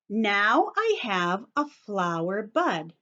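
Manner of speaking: usual speaking voice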